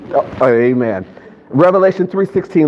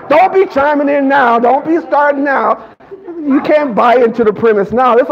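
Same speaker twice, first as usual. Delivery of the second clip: mock scolding; 8 semitones higher than usual. Real, not acted. Mock scolding audience for late laughing.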